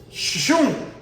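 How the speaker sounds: high zooming whoosh; whoosh sound. Acted. Cheetah speeds past the tortoise, high whooshing shoom. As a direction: voice the cheetah zipping by.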